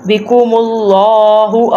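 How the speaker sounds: slow "اللہ" with a thick, dark, long l-aa; heavy lam. Shown as a demonstration. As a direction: thicken the doubled l of "اللہ" and draw it out. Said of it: "ادھر لام کو موٹا کرتے ہیں". ("اللہ" کا لام موٹا کر کے)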